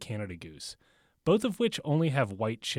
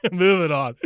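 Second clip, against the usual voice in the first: laughing; 6 semitones higher, 11 dB louder than usual. Real, not acted. Laughs off the claymore joke.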